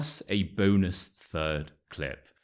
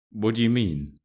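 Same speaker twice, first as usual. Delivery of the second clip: ordinary talk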